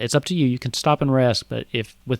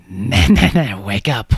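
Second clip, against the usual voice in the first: urgent inner voice, louder at the start; voice in the head; 3 semitones lower, 6 dB louder than usual. Acted. Game master as the inner voice waking an exhausted player, insistent. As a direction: jolt a drowsy host awake. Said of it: "you hear this voice in your head say".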